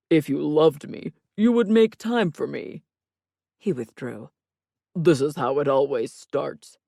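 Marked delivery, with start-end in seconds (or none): 0.1-2.8 loud, theatrical mock reproach; overacted guilt trip in a put-on voice; drama king. A narrator's reading. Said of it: "Stop being a drama king". 4.9-6.8 loud mock-tragic lament; theatrical woe; drama king. A narrator's reading. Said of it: "Stop being a drama king".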